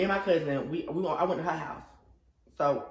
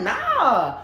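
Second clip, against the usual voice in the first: laughing no; 8 semitones higher, 8 dB louder than usual. Retold or imitated. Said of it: "I was like".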